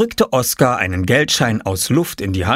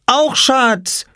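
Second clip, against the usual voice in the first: put-on high-pitched small child's call; very high, excited cry; 4 dB louder than usual. A narrator's reading. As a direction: call it out high and held, like a toddler. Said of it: "rief er".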